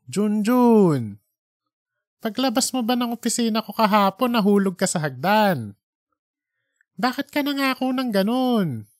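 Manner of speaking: barely held-back anger, pitched high; restrained anger. Acted. Editor holding in his anger at Junjun's broken promise. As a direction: bite back the temper while asking. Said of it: "halatang-halatang nagtitimping sagot".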